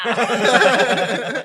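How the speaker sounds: loud high cackle; laughing. Real, not acted. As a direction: burst out laughing. Laughing at joke.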